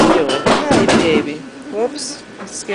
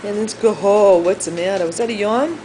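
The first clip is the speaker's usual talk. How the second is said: normal talking voice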